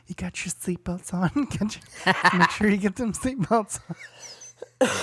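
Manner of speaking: laughing, slowing down near the end; nervous laughing warning. Retold or imitated. Telling the boys in back to buckle up before the drunk drive. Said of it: "I'm like".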